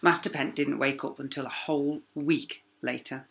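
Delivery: ordinary delivery, nothing marked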